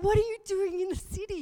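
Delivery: high, loud, fast frantic question; demanding in a panic, rushing; panicked. Retold or imitated. Panicking mother asks son why he's away, high and fast. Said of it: "there's panic in my voice".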